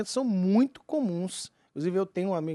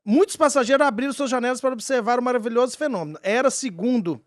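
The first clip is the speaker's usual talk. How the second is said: story narration; 5 semitones higher, 5 dB louder than usual. Acted. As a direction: narrate it like a mystery documentary. S0 narrates the UFO case.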